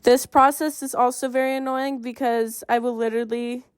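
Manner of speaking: usual speaking voice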